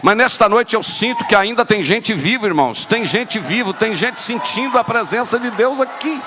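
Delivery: shouting sermon climax; fiery preaching. Real, not acted. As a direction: whip the church up, building. Preaching at full force.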